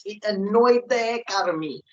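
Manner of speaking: normal talking voice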